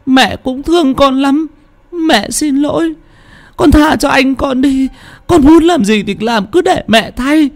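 a grieving mother begging her ghost son; mother crying out. Acted. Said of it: "Bà kêu lên". (giọng người mẹ cao vút, van xin tha thiết)

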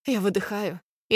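plain reading voice

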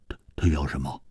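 low, hushed stammered question; tentative and very soft; hesitant asking. A narrator's reading. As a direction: ask it haltingly, almost under the breath. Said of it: "忍不住问".

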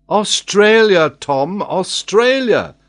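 loud, high, drawn-out shout; collective cry. A narrator's reading. Travellers all correcting Tom at once, loud cry. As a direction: cry it out slowly and insistently. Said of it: "they all cried with one voice".

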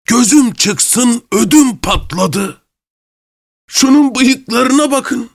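high, slow sneering mock fear; mocking fright. A narrator's reading. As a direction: pretend to be terrified, taunting him. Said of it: "keyifli bir kahkaha attı".

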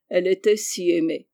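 plain reading voice

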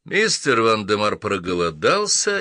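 plain reading voice

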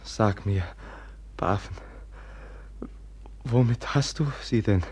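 breathless, frightened half-whisper; fearful whisper. Acted. Brother asks about the murder knife in a frightened whisper. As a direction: ask it in dread, barely voiced.

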